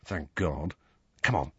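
low, soft aside; quietly. A narrator's reading. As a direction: say it quietly under cover. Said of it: "Ron told Harry quietly".

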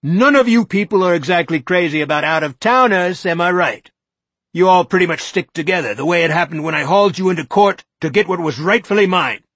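high, loud, ranting accusation; rising courtroom tirade, high and loud; courtroom shout. Acted. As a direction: hector them like a lawyer in court. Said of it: "His voice began to rise toward a courtroom shout".

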